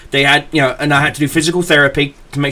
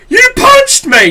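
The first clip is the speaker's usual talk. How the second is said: a high, louder furious yell, slow; retold angry shout, much higher and louder; 5 dB louder than usual. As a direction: scream it at her after being hit. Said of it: "she's like".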